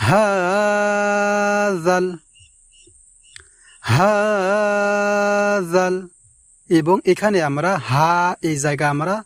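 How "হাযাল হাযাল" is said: হাযাল with the ha drawn out slowly; long vowel. Shown as a demonstration. Teacher demonstrates the elongated হাযাল. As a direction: stretch the হা of হাযাল. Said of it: "লম্বা উচ্চারণে টান দিয়ে পড়ব।".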